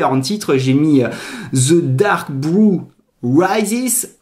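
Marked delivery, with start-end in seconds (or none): 1.5-4.2 put-on slow, drawn-out movie-trailer English accent. Reading his Batman poster title to viewers, in a slow English accent. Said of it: "J'adore mon accent anglais au passage".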